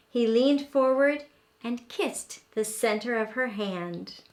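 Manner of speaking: plain reading voice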